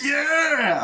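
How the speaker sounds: high, loud "yeah" whoop; mock party cheer. Nightclub crowd cheering on the night out, mock party cheer.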